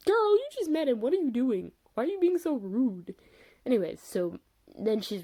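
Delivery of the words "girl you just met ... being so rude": high-pitched mock telling-off; hammed-up outrage, pitched way up; exasperated scolding. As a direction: scold a character in playful disbelief. Scolding a rude show character.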